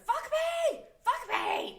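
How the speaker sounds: high shrieking yell; screaming insect. Pretend. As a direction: shriek it like a frantic bug. Insects screaming at each other.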